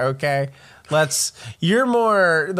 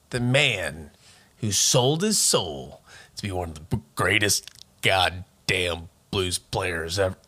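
low, slow, dramatic movie-trailer narration; deep trailer voice; 7 semitones lower, 3 dB quieter than usual. Acted. Host opening the [name] story in a trailer voice. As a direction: sell it like an epic trailer, dragging the words. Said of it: "I can't do that long".

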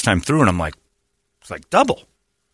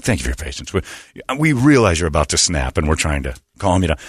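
mock pleasant hotline voice; soothing phone rep; 7 semitones lower than usual. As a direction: imitate a rep soothing an angry caller. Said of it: "They hire people to talk like that".

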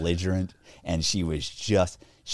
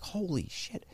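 hushed dismay; 10 semitones higher, 11 dB quieter, slower than usual. Retold or imitated. Realizing he's busted.

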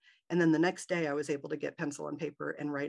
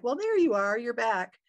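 higher, louder bright greeting of a nurse; cheery nurse; 7 semitones higher, 6 dB louder than usual. Retold or imitated. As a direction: greet the waking patient like a chirpy nurse. Nurse greets her waking up.

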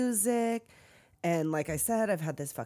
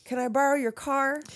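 higher mock wheedling question; mooching neighbor; 6 semitones higher, 4 dB louder than usual. Imitating Felicia asking for the car, mock mooching. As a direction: lightly imitate a cadging neighbor. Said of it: "She's like".

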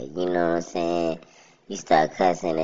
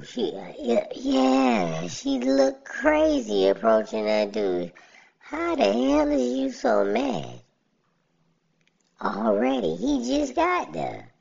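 exasperated rant. Real, not acted. Ranting about aggressive lady.

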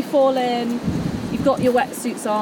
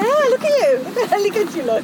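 excited; 8 semitones higher, 5 dB louder than usual. Real, not acted. Teasing praise on the board.

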